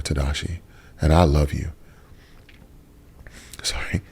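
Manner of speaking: real emotion welling up, voice dropping; choked up. Real, not acted. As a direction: get quietly choked up. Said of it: "cry in front of you".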